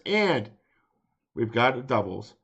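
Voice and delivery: normal talking voice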